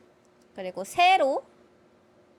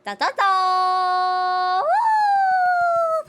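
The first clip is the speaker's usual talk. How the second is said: fanfare reveal; 5 semitones higher, 7 dB louder, much slower than usual. Acted. Presenting haul with fanfare.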